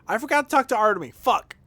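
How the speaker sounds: high, loud groan of annoyance at himself; frustrated outburst. Real, not acted. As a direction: kick yourself out loud for forgetting. Streamer annoyed at own mistake.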